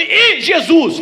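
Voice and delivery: high-pitched alarmed yelp of recognition; startled devil. Acted. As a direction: play the devil spotting his enemy. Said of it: "O diabo quando olha pra mim".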